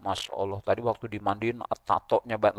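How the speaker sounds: hushed imitation of tattling mourners; mock gossiping. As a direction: lightly act out gossip, voice dropped. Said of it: "Masih jadi omongan".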